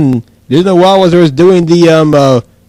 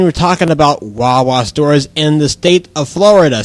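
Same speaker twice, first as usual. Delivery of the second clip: usual speaking voice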